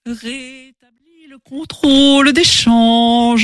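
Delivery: slow, high, eerie; siren voice. Acted. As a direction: intone it like a spell. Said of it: "des voix suaves".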